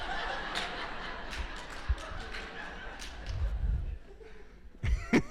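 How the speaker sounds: long breathy laugh at the memory; real laughter. Real, not acted. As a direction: laugh it out freely. Laughing at his own story.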